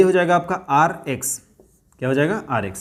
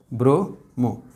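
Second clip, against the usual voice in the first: slower softer repeat; 5 semitones lower, much slower than usual. Shown as a demonstration. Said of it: "आप क्या बोलेंगे इसको?".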